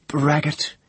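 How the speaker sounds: high scornful cry; scornful girl. Acted. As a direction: spit the insult at him. Priestess scorning the prisoner.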